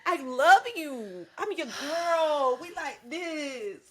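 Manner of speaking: high, loud pleading to the Lord; hammed-up wail at God, slowing down; theatrical high plea to God; mock pleading prayer.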